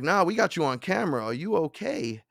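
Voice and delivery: high, concerned cop voice. Retold or imitated. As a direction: ask like a worried cop.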